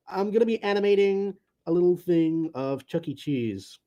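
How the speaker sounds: ordinary delivery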